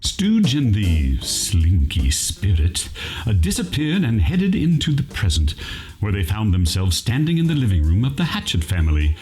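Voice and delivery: ordinary delivery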